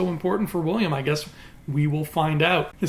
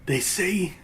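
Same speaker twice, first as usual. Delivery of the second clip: intense villain quote. Acted. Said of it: "say it with the same intensity that he did".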